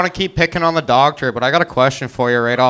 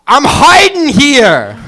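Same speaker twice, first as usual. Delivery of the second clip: put-on loud, slow, higher New York accent yell; brash New York accent shout; New Yorker impression; 11 semitones higher, 8 dB louder, much slower than usual. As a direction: belt it like a New Yorker on the street. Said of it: "New Yorker playing hide and seek".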